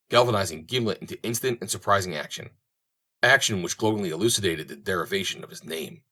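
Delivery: ordinary delivery, nothing marked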